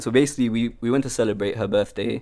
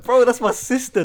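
high-pitched indignant protest; high, incredulous retold outburst; mock exasperation; 12 semitones higher, 3 dB louder than usual. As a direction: protest it in exaggerated disbelief. Retelling own indignant reply.

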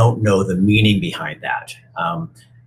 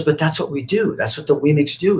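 higher little puppet, insisting; higher protesting puppet; 9 semitones higher than usual. Retold or imitated. Punchinella protests to Eli, higher. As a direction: protest like a small toy who knows no better.

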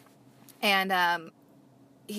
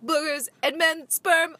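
hammed-up TV detective, climbing higher and louder; playful gruff cop impression that breaks high at the end; 8 semitones higher, 5 dB louder than usual. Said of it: "Ice-T's all walking up in there like".

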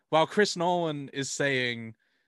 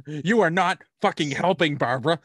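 mock scolding; 2 semitones higher, 7 dB louder than usual.

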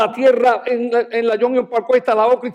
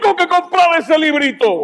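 retold yell; 6 semitones higher than usual. Pastor still yelling at God that he won't buy the book. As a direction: keep shouting the refusal. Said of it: "Y grité".

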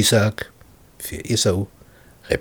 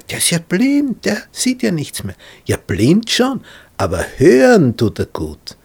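anxious Jacob; 9 semitones higher, 4 dB louder than usual. Retold or imitated. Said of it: "Und der Jakob sagt".

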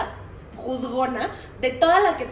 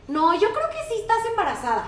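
lightly voiced kid, a bit higher; insistent boy; 8 semitones higher than usual. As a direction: insist like a stubborn six-year-old.

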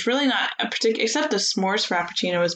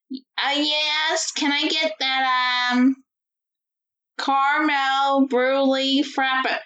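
put-on high, slow, drawn-out old-lady order; grandma ordering; 5 semitones higher, much slower than usual. Granddaughter imitates grandma ordering at Starbucks, high and halting. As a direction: fumble slowly through the order like grandma. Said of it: "watching my grandma order from Starbucks".

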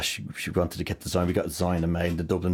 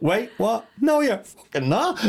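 high-pitched shriek of disbelief, laughing; shocked, squealed retelling with a laugh; imitated disbelieving shout; 4 dB louder, much slower than usual. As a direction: mimic a stunned niece shouting out. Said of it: "Abby actually shouted out".

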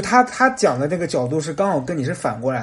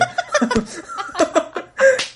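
high laugh. Real, not acted. Laughing at the story.

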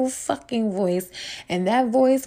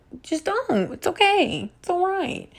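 higher, sweet mock comfort; patronizing; 9 semitones higher than usual. Host mocking know-it-alls, sugary condescension. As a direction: soothe them like a silly child.

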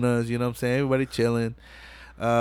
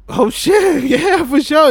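delighted Brian; 14 semitones higher, 5 dB louder, slower than usual. Retold or imitated. Brian accepts Mia's date offer.

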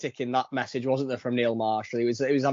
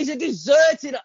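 high loud shout; 13 semitones higher, 5 dB louder than usual. Real, not acted. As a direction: yell it out. Correcting friend, shouting.

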